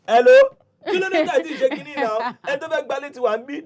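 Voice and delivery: high, loud outraged yell; exasperated shout. Retold or imitated. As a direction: shout it out in disbelief. Tenant confronting the agent.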